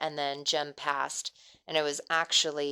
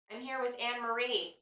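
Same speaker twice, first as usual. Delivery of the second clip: higher, gentle coaxing voice; talking to a ghost; 5 semitones higher than usual. Real, not acted. Addressing the ghost in session.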